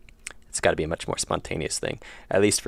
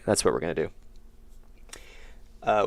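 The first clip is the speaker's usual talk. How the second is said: normal talking voice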